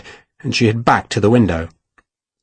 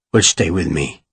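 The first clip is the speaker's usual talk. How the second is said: a quiet command that leaves no choice; menacing villain voice; 8 semitones lower, 4 dB quieter than usual. A narrator's reading. Ferragus claims her.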